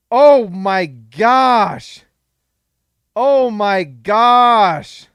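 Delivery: very slow, loud drawn-out words; overjoyed and stunned; stretched-out awe. Real, not acted. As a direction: savor each word in disbelief. Still celebrating the pick.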